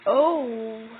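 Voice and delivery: sudden loud startled cry; startled yelp. Real, not acted. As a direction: jump at the scare. Jump scare in horror game.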